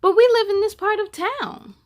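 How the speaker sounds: an upset aunt protesting to the secretary. Acted. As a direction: push back hard, indignant. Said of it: "Aunt Soledad was upset".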